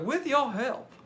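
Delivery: high-pitched televangelist plea; mock sermon opening. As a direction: preach it like a TV evangelist. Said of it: "uh, televangelists".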